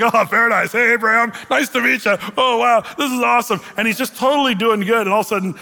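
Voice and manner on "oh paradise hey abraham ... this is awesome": higher and louder, mock delighted Lazarus; delighted in paradise. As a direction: gush like a tourist in heaven. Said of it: "he's like".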